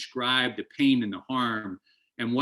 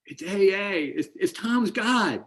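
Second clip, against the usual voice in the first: high, slow, stretched-out cry of joy; imagined scream; 10 semitones higher, much slower than usual. Retold or imitated. To the old man, a held-in scream of thanks, high and slow. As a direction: drag it out high like a silent shout of gratitude. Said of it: "I wanted to scream out to him".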